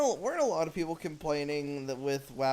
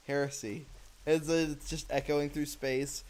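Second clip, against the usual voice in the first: usual speaking voice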